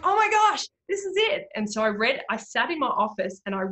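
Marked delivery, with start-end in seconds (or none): 0.0-1.5 high, loud burst of excitement; retelling her own thrilled discovery of the verse, excited; excited realisation. Retold or imitated. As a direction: gasp it out like a breakthrough.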